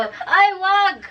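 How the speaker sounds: put-on high, loud "Oi, wag" like scolding a kid; shouted scolding. Shown as a demonstration. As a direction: yell "Oi, wag" at someone. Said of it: "try to translate that back to Tagalog".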